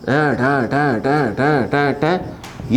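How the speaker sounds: rhythmic run of imitated clicking syllables; spring-compression noise. Shown as a demonstration. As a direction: tick off each squeeze of the spring. Imitates spring being compressed step by step. (Spring दबने की लयबद्ध आवाज़)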